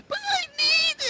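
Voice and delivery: put-on high, crying whiny child voice; whiny kid. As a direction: wail it like a seven-year-old. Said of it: "talking like a seven-year-old kid".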